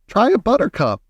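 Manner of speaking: high, eager cry; Mister Wonka crying out. A narrator's reading. Host urging guests to try a buttercup, eager cry. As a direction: call it out brightly pitched and keen. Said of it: "cried Mister Wonka".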